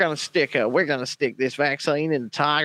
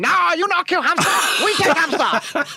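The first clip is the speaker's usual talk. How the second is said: broken English voice; 10 semitones higher than usual. Retold or imitated. Mock foreign voice for Hong Kong.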